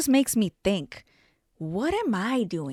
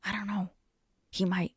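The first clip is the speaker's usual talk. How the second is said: soft, fast, hushed regret; mock muttered panic; nervous inner voice; 2 semitones lower, 6 dB quieter, faster than usual. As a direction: rush it under your breath, worried. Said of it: "she even had that face like".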